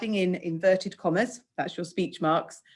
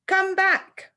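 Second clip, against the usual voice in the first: a much higher, louder shout; 14 semitones higher, 5 dB louder than usual. Acted. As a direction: yell it after someone running away. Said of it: "The policeman shouted".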